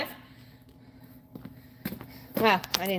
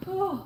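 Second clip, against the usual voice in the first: high breathy whew, winded; tired whew; 9 semitones higher than usual. Real, not acted. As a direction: let out a spent breath. Exhale after a hard set.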